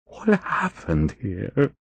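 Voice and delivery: slow shaky question; quavering voice. A narrator's reading. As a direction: let the voice tremble, slow. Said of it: "he asked in a quavering voice".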